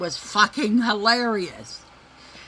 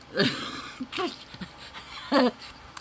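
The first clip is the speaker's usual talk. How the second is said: silent breathy laugh; 6 semitones higher than usual. Real, not acted.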